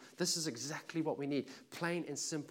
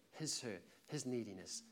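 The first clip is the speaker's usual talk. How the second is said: normal talking voice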